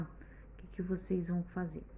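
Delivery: a hushed prompt to the class; whispered question. Real, not acted. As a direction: whisper the question, unvoiced and soft. Teacher aside to the children.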